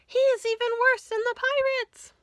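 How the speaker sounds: very high, loud wail; terrified cry. Acted. Auntie Pastry warning everyone of the monster, very high and loud. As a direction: wail in fear. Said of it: "she cried".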